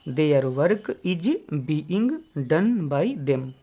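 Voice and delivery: word by word, with gaps; slow dictation. Shown as a demonstration. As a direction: dictate slowly for students to write. Repeats answer for writing down. (धीरे-धीरे बोलकर लिखवाना)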